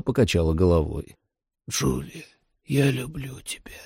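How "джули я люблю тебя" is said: soft, high, failing voice; barely audible whisper. A narrator's reading. Dying father telling his daughter he loves her, whispering. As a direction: breathe it out as a dying farewell. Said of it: "прошептал Рэй едва слышно".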